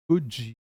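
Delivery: could you run together fast as "cujoo"; linked could you. Shown as a demonstration. Teacher shows students the fast linked could you. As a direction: blend could you into one quick "cujoo". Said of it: "ये बन जाता है ऐसे".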